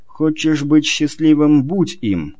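ordinary delivery, nothing marked

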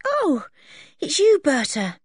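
high, drowsy recognition; sleepy. A narrator's reading. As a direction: greet her high-pitched, just woken. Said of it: "said Anne's sleepy voice".

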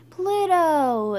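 high, loud crowd shout, drawn out; yelled cheer. A narrator's reading. The underdogs answer Skunk's cheer, storybook yell. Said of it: "yelled the underdogs".

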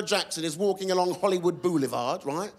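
normal talking voice

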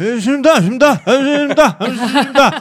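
high, loud Japanese take on Korean, laughing; Japanese comedian mocking Korean. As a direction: Japanese comic aping Korean speech, slurring the start and punching 쉽니다. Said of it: "일본 개그맨이 이렇게 한국 사람 흉내 내는 거".

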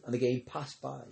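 usual speaking voice

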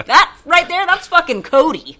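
hammed-up protest that the kid is obviously Cody; loud mock insistence. Mom retelling her thoughts, loud laughing mock insistence.

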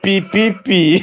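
high squeaky mouse peep; peeping baby chick. Pretend. Playing mouse at party.

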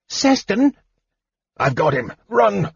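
high-pitched urgent call. A narrator's reading. Kender calling to companions fleeing a dragon, urgent high cry.

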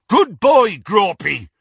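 loud, high hearty praise; bellowing. A narrator's reading. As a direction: bellow praise to someone far outside. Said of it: "he bellowed through the hole in the window".

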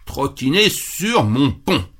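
slow, high opening troll roar. Acted. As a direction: draw out the challenge menacingly. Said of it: "rugit le treuil".

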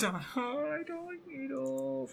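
panicky whimper. Acted. Frightened patient to Donna about needles, slow high whimper. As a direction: whine it slowly, small and high.

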